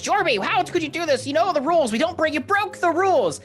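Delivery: very high, outraged squawking; yelling angry otter. Acted. Otter folk telling off Jorby for bringing outsiders, shrill yelling. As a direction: scold him in a frantic high-pitched rant. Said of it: "yelling at him 'cause they've brought outsiders".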